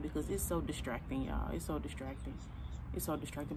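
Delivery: muttering under her breath, distracted. Real, not acted. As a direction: trail off quietly, distracted by surroundings. Distracted muttering while filming outside.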